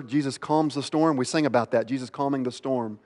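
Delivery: usual speaking voice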